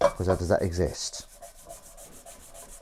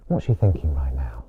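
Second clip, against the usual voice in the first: hushed lower whisper; whispered musing; 8 semitones lower than usual. Real, not acted. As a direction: wonder aloud in a breathy whisper. Musing about kitten.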